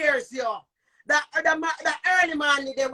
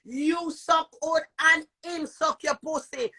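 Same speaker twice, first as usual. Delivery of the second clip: usual speaking voice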